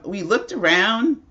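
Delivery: ordinary delivery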